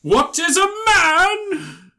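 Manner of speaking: high, slow, booming theatrical line; dramatic villain quote. Retold or imitated. Quoting Castlevania in a grand high voice. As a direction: proclaim it like a gothic villain.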